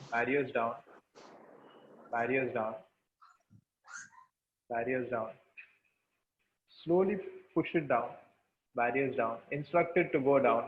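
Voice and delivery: low, soft repeated mantra; guided meditation. Shown as a demonstration.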